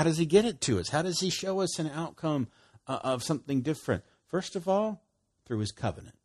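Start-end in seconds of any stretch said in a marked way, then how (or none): none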